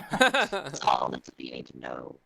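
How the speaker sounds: garbled robotic mic; glitchy low-bitrate connection; low sample rate. Real, not acted. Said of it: "your voice is robotic".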